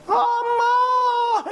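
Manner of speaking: long high drawn-out shout of mom; child calling mom. Retold or imitated. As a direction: call out like a child wailing for mother. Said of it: "해야 되니까".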